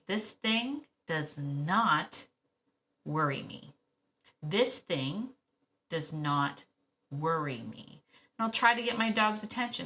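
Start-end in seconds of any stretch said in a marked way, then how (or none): 0.1-8.0 "This thing does not worry me" said very slowly, each word weighted, sinking low; slow deliberate calm. Shown as a demonstration. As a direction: spell out calm confidence word by word. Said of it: "I'm saying".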